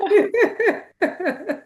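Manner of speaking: a quick high burst of laughter; bright laugh. Real, not acted. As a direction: laugh out happily after the cheer. Host laughing after welcome.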